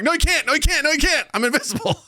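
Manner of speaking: high, frantic repeated protest; mock desperate denial. Acts desperate denial in dream.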